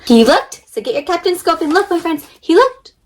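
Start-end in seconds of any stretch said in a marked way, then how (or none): 2.4-2.9 building suspense. A narrator's reading.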